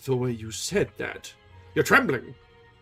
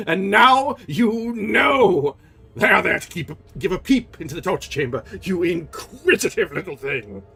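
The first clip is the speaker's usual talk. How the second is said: louder, higher roar; roaring angry; 7 semitones higher, 10 dB louder than usual. Acted. Erik triumphant at Christine, roaring angry. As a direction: roar it at her. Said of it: "the angry voice roared".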